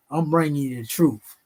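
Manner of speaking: ordinary talk, nothing marked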